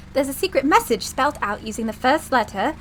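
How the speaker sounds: put-on British accent for a game character. Assistant explains the secret message to the professor, British accent. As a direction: read it out in an attempted British accent. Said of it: "Every time I use a British accent".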